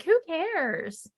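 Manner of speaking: imitating the co-host's shrug; dismissive. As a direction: shrug it off in a mocking voice. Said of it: "you're like".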